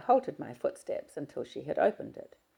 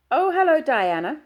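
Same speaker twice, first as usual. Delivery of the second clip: bright, raised, louder chatty greeting; a friendly colleague calling up, higher and louder; Maureen's voice; 13 semitones higher, 10 dB louder than usual. Acted. Maureen greets Diana on the phone, higher and louder.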